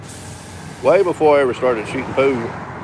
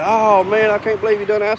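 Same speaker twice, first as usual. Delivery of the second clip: retold exasperated disbelief; 8 semitones higher than usual. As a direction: imitate their dismay, pitched well up. Retold crowd reacting to thrown game.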